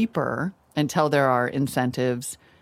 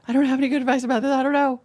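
nervous rush; 11 semitones higher, much faster than usual. Real, not acted. Nervous about retirement question.

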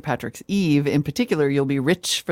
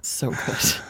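breathy whispered craving, lower; 7 semitones lower, 4 dB quieter than usual. Real, not acted. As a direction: murmur it like tasting something. Craving cereal aside.

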